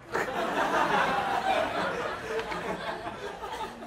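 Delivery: long airy laugh; real unvoiced laugh trailing down; breathy laugh. Real, not acted. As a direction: laugh it off, almost silent. Long laugh after twin punchline.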